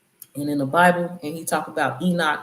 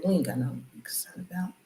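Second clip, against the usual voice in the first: quick whispered aside; hushed and rushed under the breath; 9 dB quieter, much faster than usual. Real, not acted. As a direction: let this slip out in a fast whisper. Aside whispered in mid-monologue.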